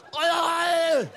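furious mock Danish outburst. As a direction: slam the table and cry out. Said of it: "blir man ju vansinnig".

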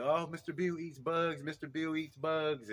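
put-on high child voice, drawn out at the end; imitated little boy. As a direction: announce it like an amazed small kid. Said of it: "you know, there was".

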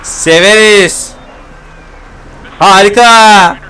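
excited whoop. Real, not acted. Excited stunt while driving.

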